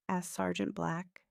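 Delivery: usual speaking voice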